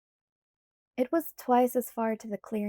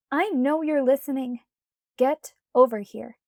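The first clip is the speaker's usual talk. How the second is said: louder, commanding; loud demand; 3 semitones higher, 8 dB louder than usual. A narrator's reading. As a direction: order Edward over, irritated. Bella commanding.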